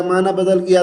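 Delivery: ordinary delivery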